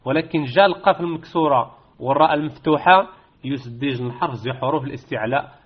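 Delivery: ordinary delivery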